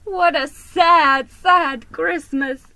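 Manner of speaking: slow, loud, high wail; bawling. Acted. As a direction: bawl it out in misery. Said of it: "he bawled".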